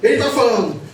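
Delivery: loud, high, impassioned sermon delivery; fiery preaching. Acted. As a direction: preach it out to the congregation. Preaching at full force.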